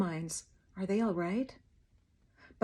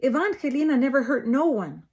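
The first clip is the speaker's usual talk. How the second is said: loud, high protest; protesting father; 5 semitones higher, 6 dB louder than usual. A narrator's reading. Father protests daughter's innocence, loud. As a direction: defend her with force.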